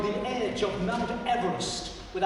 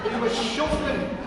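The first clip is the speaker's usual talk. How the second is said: plain reading voice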